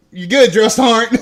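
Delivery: loud, teasing question; mock concern. Recruit mocking the drunk sergeant, loud teasing. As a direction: tease him at full volume. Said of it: "mocking the drunk sergeant".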